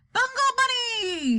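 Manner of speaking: high, drawn-out wild cry; scream. A narrator's reading. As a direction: stretch it into a high, sliding scream. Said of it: "he screamed".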